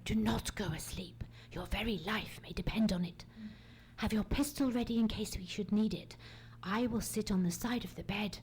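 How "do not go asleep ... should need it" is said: whisper. Acted. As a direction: breathe the words so no one hears. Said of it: "speak only like this".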